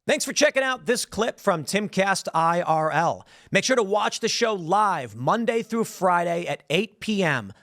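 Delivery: measured host sign-off, plugging the show; show outro read. Acted. Host asks viewers to watch and subscribe, in promo outro style.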